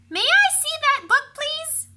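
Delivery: put-on high, loud eager child voice; polite little girl. A narrator's reading. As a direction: ask nicely like a small girl. Beatrice voiced, asking politely.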